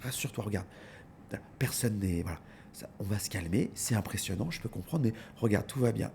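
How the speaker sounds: put-on calm a crying six-year-old softly; soothing a child. Owner soothing the crying little girl, soft and hushed. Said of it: "j'ai fait".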